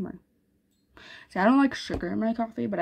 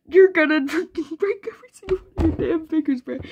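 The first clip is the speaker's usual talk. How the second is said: laughing; 8 semitones higher, 4 dB louder than usual. Retold or imitated. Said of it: "Bro said".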